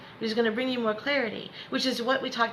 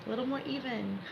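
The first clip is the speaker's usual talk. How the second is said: soft, lowered breathing-exercise voice; guided meditation; 8 dB quieter than usual. Acted. Coach guiding students to breathe in and out, soothing meditation voice. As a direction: ease them gently into the breath, leaving space.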